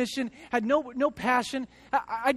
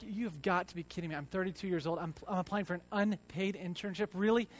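quiet disbelief; 4 semitones lower, 7 dB quieter than usual. Retold or imitated. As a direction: lower and softer, muttering in disbelief. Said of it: "And I was like".

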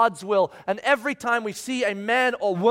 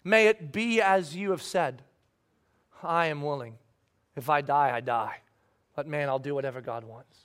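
much softer and lower, tender resolve; quiet surrender; 7 semitones lower, 5 dB quieter than usual. Acted. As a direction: hush it like a vow. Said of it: "here's her response".

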